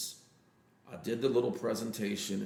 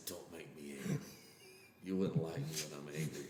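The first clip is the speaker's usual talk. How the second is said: low, softer menacing warning; quiet threat before the storm; Hulk threat; 5 semitones lower, 10 dB quieter than usual. Retold or imitated. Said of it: "I wanna look at him and say".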